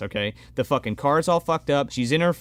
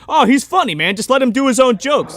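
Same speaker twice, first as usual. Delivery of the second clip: higher, louder mock enthusiasm; mock indulgent filmmaker; 7 semitones higher, 5 dB louder than usual. Filmmaker letting the comedian ad-lib jokes, mock enthusiastic. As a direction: play an easily impressed colleague waving it through. Voicing whoever allowed the ad-libbing.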